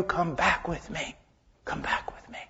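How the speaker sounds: hushed, loving call to return; tender plea. Acted. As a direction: coax softly, dying away to a breath. God's plea fading to whisper.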